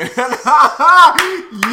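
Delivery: high burst of laughter; loud laugh. Real, not acted. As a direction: explode laughing. Laughing at the clip.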